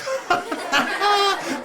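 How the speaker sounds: high laughing giggle. Real, not acted. Host giggling at his fly joke, high laugh. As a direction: crack up high and bright.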